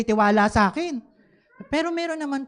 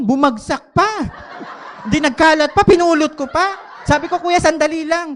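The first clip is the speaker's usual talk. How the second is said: flustered excitement; 7 semitones higher, 8 dB louder than usual. Real, not acted. Phone dropped during holdup.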